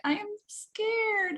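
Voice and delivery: put-on high, slow small child's voice; be a frightened small child; imitating a timid kid, high and slow; scared little kid. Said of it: "a little kid was next to us saying".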